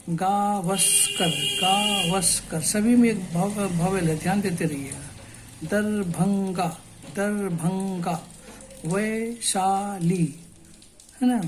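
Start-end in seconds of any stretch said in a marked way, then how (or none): 0.1-2.6 Gavaskar stretched slowly, syllable by syllable; teacher models Gavaskar slowly for the class. Shown as a demonstration. Drawn-out demo. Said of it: "उसको slowly तोड़ते हुए". 5.6-10.4 Darbhanga and Vaishali drawn out slowly; teacher breaks place names slowly into syllables. Shown as a demonstration. Slow syllable demo. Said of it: "उसको slowly तोड़ते हुए".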